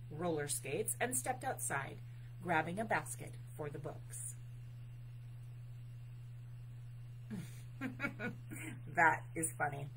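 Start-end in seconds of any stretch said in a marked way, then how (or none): 4.4-8.9 real, breathy chuckle; amused laugh. Real, not acted. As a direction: chuckle at a funny picture. Reader amused by the picture.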